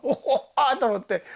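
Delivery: ordinary talk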